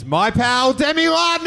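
climbing to a long, high holler; shouted guest introduction. Real, not acted. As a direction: build up and stretch the name into a yell. Shouting the guest's name on stage.

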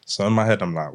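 ordinary delivery

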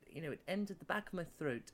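normal talking voice

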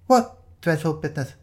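a lightly voiced startled woman; a touch of alarm from Penny; Penny's voice. A narrator's reading. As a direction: ask it sharply, caught off guard.

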